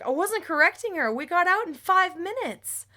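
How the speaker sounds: high, loud self-defense; indignant protest. Retold or imitated. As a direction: re-enact defending yourself in a quarrel. Acted quarrel, defends herself.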